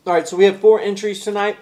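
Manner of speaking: talking through a mouthful; mouth full. Real, not acted. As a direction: speak around a bite of food. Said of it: "I'm talking with my mouth full".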